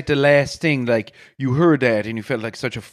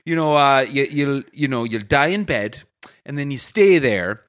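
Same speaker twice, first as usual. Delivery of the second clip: put-on storytelling in a broad Irish accent, rolling along. Irish bar owner telling his funeral story in an Irish accent. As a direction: spin the yarn like an Irish pub teller.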